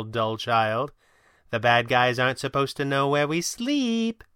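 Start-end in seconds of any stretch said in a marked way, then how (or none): 1.5-4.2 higher, condescending sing-song; sing-song scolding. A narrator's reading. Kit scolds the Red Panda about secret identities in sing-song. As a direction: spell it out as to a dull child. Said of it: "sing-song tone that was meant to scold".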